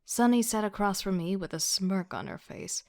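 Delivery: ordinary delivery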